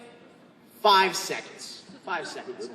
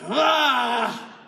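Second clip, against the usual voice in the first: short angry shout out; 2 semitones higher than usual. Shown as a demonstration. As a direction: let the anger burst out. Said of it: "let that anger out at the end".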